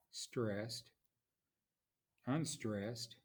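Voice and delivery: ordinary delivery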